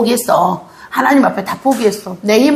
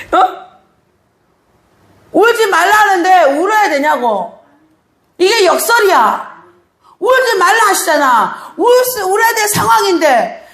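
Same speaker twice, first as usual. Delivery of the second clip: impassioned pulpit preaching, higher and pressing; preaching at full force; 7 semitones higher than usual. Acted. Pastor urging the congregation in full preaching voice. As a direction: drive the point home to the congregation.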